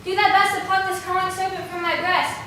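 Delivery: loud, high, panicked pleading; frightened cry. Acted. Hermia waking from a serpent dream, calling Lysander, terrified. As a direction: cry out for help from a nightmare.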